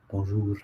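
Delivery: bonjour with a soft southern French R; bonjour, southern French style; rolled-R French. Shown as a demonstration. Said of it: "it sounds quite sweet, I think, when people say that".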